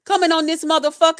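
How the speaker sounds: ordinary talk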